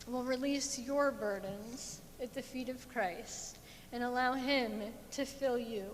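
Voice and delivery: ordinary delivery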